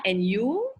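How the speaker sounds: ordinary delivery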